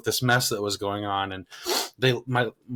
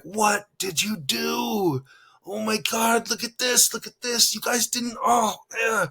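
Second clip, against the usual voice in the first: exasperated dad; 10 semitones higher than usual. Retold or imitated. Dad upset at the kitchen mess.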